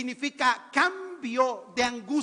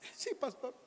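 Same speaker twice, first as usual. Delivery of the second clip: tearful reply; 11 dB quieter than usual. Acted. Acts the tearful sister replying.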